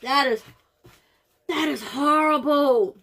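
real distress after tasting the snack; near-crying disgust. Real, not acted. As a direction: drag it out in anguish. Gagging on the snack.

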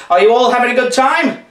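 high, loud, bright call-out to an audience; calling to a crowd. Pretend. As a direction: hype up a room of kids.

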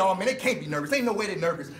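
ordinary talk, nothing marked

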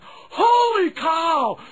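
high, slow excited shout; Holy cow catchphrase. Acted. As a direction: draw out the holy. Harry Caray catchphrase.

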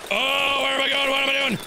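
high, frantic self-questioning; flustered panic. Real, not acted. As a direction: blurt it out while running lost. Fleeing in confusion.

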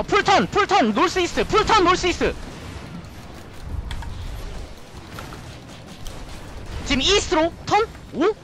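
high, urgent countdown to turn; hyped shotcall. Real, not acted. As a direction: drive the team into the fight. (다급하게 외치는 콜)

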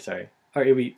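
usual speaking voice

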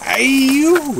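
high yelp of pain; sudden loud pained cry; ayyo wail. Real, not acted. Pricked by thorns, yelps.

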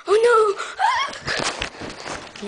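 high cry breaking into a squeal; hammed-up alarm, pitch shooting up; startled fright. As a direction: yelp as if ambushed by a dog. Dog suddenly appears.

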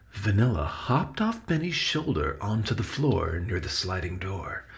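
plain reading voice

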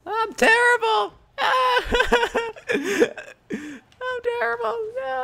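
high wail through laughter; helpless self-mockery. Real, not acted. Player laughing at own bad play, helpless wail.

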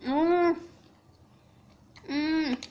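humming while chewing food; closed-mouth murmur eating; mouth full, chewing. Real, not acted. Seen 0: Closed mouth chewing after biting chicken at -3s; fork near.

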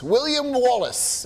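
lightly put-on Scottish accent; a hint of a Scottish brogue. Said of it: "I have a terrible Scottish accent".

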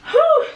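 high loud panting whew; breathless whew. Real, not acted. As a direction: blow out a tired whew. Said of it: "I'm a lot out of breath".

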